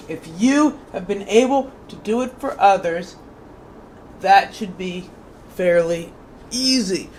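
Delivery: measured inspirational book reading; reading aloud. Acted. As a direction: read it like a devotional passage to the listener. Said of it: "I did a great job reading".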